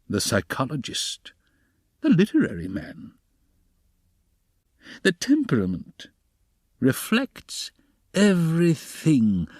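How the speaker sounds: knowing, swooping monologue with little chuckles; sly investigator. A narrator's reading. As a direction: toy with the listener, stretching key words high. Narrator voices a sly investigator's monologue.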